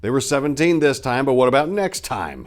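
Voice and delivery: loud, high, hard-driving question; rhetorical demand. A narrator's reading. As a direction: push the point hard and loud. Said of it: "he asked rhetorically".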